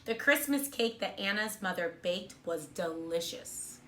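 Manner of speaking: ordinary delivery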